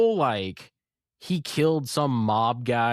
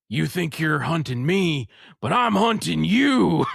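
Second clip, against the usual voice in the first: mock menacing villain line, rising higher and louder; villain cliche; 6 semitones higher, 6 dB louder than usual. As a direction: ham up the cheesy villain threat. Villain's clichéd threat quoted.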